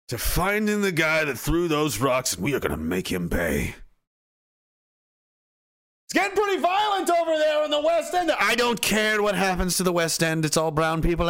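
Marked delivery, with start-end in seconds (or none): 2.3-3.7 low grim vow. Pretend. Police chief vowing revenge, low and grim. 6.0-8.3 high shouted warning; alarmed shout. Pretend. Officer warning the commissioner, high shout.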